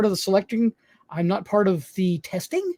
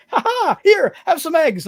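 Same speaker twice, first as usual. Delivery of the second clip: much higher, louder mock cackle; hammed-up taunting family offering eggs; teasing family; 9 semitones higher, 5 dB louder than usual. Family handing the host eggs, high mocking taunt. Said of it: "They were like".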